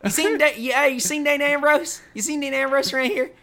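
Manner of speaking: a high little Southern accent, mocking a wrestler; Jamie Noble Southern accent. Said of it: "in his thick Southern accent".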